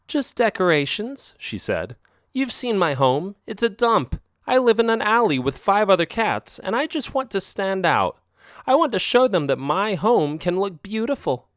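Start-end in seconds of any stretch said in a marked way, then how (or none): 0.1-1.4 higher, teasing dodge; coy girlfriend cat. Acted. Girlfriend cat brushing off his question, coy and higher. Isabel answers. 2.3-11.5 raised, pleading cat voice; higher-voiced girlfriend cat. Girlfriend cat pleading about her shabby alley home, higher voice. Isabel explains wish.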